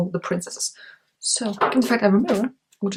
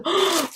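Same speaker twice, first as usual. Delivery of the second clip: delighted gasp; 9 semitones higher than usual. Real, not acted. Unboxing the palette.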